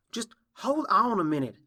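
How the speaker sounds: high, loud demand to wait; urgent protest. Acted. Applejack stepping in front of the filly, urgent protest. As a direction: raise your voice to stop them leaving.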